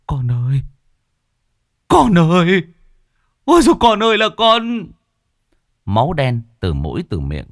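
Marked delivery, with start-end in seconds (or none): 0.1-4.9 loud, high anguished wail; father wailing over his drowned son; wailing cry. A narrator's reading. Father wailing over drowned son's body, loud. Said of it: "hét lên một tiếng".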